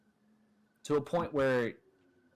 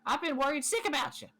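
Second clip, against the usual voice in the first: high, fussing little critter; fussy little mouse voice; 12 semitones higher, 4 dB louder than usual. Acted. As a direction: scold like a worried tiny mouse. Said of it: "Cinder goes".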